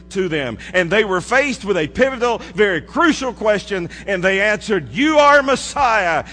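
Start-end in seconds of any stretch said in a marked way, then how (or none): 4.9-6.2 higher, louder, slow proclamation; triumphant declaration; preaching climax. Acted. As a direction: shout the confession out. Disciples' climactic confession.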